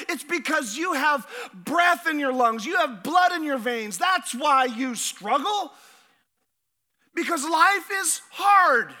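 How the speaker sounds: loud, emphatic sermon delivery; forceful preaching. Acted. Pastor preaching hard to the congregation, forceful.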